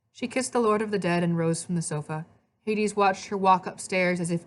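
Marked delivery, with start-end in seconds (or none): none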